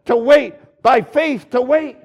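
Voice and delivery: loud, forceful preaching. Acted. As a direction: drive the point home from the pulpit.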